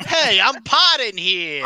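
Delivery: Ratso Rizzo parody. Pretend. A mock New York yell that riffs on the Rizzo quote. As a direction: shout it like a New Yorker dodging a car. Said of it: "quote from Rizzo the Rat".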